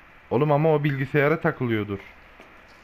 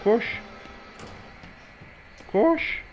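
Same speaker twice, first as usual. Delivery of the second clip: being chased; 6 semitones higher, 3 dB quieter than usual. Real, not acted. Being chased, urging to run.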